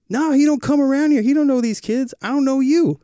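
high, loud imitated yell; mock yelling neighbor. Retelling the neighbor yelling at him, mock angry yell. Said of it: "And then started yelling at me".

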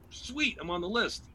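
high, drawn-out delight; pleased relief. Retold or imitated. Comic finding his name on the list, high and pleased. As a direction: stretch the first word high, savouring it.

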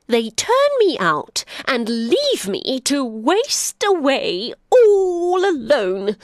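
put-on bitter old donkey. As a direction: let the old hurt crack through.

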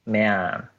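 "メアン" with E sliding into A; E-like vowel demo. Shown as a demonstration. Repeating man for the co-host, E-like vowel demo. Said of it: "もうちょっとEっぽい発音".

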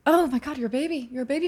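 high, soft coo of amazement; cooing surprise. Real, not acted. Hearing a friend is only twenty-three, cooing in surprise.

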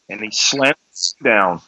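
ordinary talk, nothing marked

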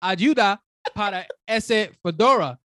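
put-on Spanish with a gringo accent; American accent Spanish. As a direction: speak Spanish like an American learner. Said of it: "I sound like a gringo".

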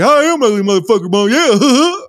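high, fast, garbled mock cry; whining Junior. Podcaster mimicking the insulted son agreeing with Mom, high tearful babble. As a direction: dim son wailing along, hammed-up. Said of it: "And he's like".

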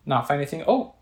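ordinary talk, nothing marked

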